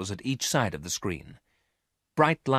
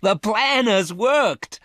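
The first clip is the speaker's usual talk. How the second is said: high, loud, drawn-out cry of triumph; excited cry; 12 semitones higher, 8 dB louder, slower than usual. A narrator's reading. Miranda hearing the plan has worked, excited cry. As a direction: cry out with joy, stretching the words. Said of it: "she cried excitedly".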